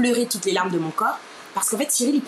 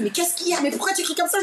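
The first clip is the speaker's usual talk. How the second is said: put-on boyfriend's high-pitched startled questioning; retold alarmed cry; 9 semitones higher, faster than usual. Boyfriend asking her why she screams, high retold cry. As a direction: imitate a panicked partner, pitched up. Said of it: "Mon mec, il, qui crie".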